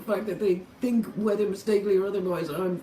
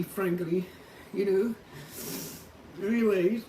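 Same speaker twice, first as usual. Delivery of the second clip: ordinary talk, nothing marked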